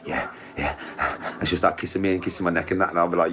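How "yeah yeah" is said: mock breathy whisper. Voicing Tony, breathy reply.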